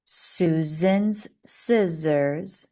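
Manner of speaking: very slow, drawn-out twister; very slow teacher model; very slow model reading. Shown as a demonstration. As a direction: stretch every word for learners. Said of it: "One more".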